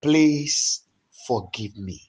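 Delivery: passionate repentance prayer that swells into shouts; fervent prayer. Shown as a demonstration. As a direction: cry out to God for pardon, building and falling. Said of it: "Go ahead and pray".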